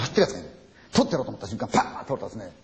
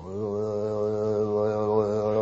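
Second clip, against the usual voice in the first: slow, drawn-out eerie call; approaching ghostly call; 4 semitones lower, much slower than usual. Retold or imitated. Storyteller imitating the ghost voice coming closer, slow eerie call. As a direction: draw the call out as it comes nearer. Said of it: "って来るんですよ".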